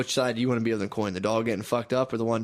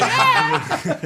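loud, high squeal through laughter; high mock yelp; 8 dB louder than usual. Dogs panicking at the dog park, high laughing yelp.

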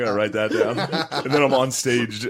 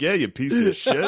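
mock heckle; 8 semitones higher, 4 dB louder than usual. Imitates joining the crowd's heckling.

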